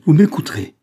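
loud, high insistent cry; exclamation impérieuse. A narrator's reading. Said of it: "s'écria-t-il". (cri impérieux, voix haute et forte)

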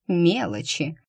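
plain reading voice

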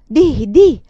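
loud pressing "Di, di"; insistent urging. A narrator's reading. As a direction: press her to go on. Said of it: "sempre faceva quando ella s'arrestava".

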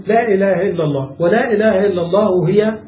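normal talking voice

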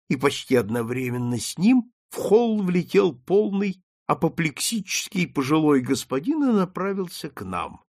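plain reading voice

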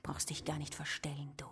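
whisper. Acted. Contemptuous hissed aside.

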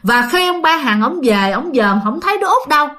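usual speaking voice

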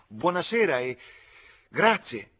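high, loud farewell call. A narrator's reading. Coachman bids the couple good evening, calling out. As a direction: call a polite goodbye up to a window.

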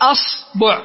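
أصبع said asbu', u after the b; a then u. Shown as a demonstration. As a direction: demonstrate أصبع as AS-BU'. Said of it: "والباء مثلثة".

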